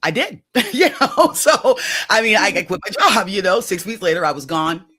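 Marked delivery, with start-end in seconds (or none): 0.0-3.6 high and loud, laughing through it. Real, not acted. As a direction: laugh out the confession. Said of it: "laughs while saying it".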